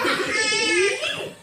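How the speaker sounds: tawa tinggi dan keras; tawa lepas. Real, not acted. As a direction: tertawa terbahak-bahak. Laughter after reply.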